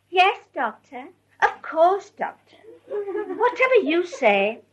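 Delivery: lightly imitating an overly polite tone for doctors; doctor's voice. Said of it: "she was talking to the doctor in that doctor's voice".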